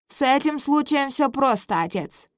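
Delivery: high, loud haughty prince. Acted. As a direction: speak up smugly to father. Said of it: "подаёт голос принц Марциан".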